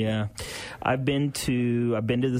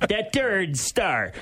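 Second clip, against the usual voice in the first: high, slow announcer boom; arena announcer; 8 semitones higher, much slower than usual. Pretend. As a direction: stretch it out like a PA announcer. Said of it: "everything in a French accent".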